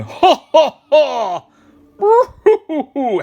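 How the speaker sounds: loud high ha-ha-ha into a whoop; big mock cowboy guffaw and holler; hammed-up cowboy laughter. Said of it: "Cowboys are laughing".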